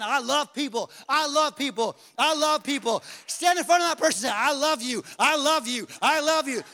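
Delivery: loud, building repeated proclamation; forceful preacher's acted insistence; insistent declaration.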